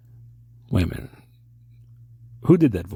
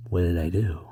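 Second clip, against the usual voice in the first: ordinary delivery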